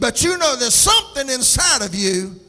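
loud, high, driving preacher delivery; preaching at full force. Real, not acted. Preacher urging Christians to believe God put something inside, full-force preaching.

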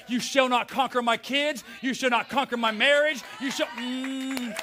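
battle-ready pulpit declaration; fired-up preaching. Acted. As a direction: preach defiantly, climbing toward a cry. Preaches at full force.

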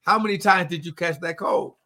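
ordinary talk, nothing marked

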